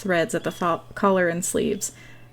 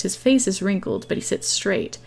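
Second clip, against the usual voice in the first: usual speaking voice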